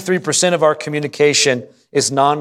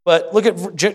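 normal talking voice

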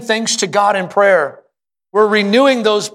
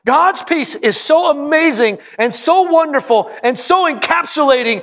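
preaching at full force, climbing higher on each line; rising preaching; 7 semitones higher than usual. Acted. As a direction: build the praise of God's peace, voice lifting. Preaching climax on God's peace.

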